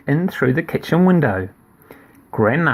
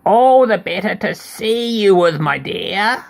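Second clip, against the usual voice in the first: lightly voiced granny wolf; 6 semitones higher than usual. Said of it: "said the wolf".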